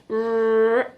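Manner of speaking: ordinary talk, nothing marked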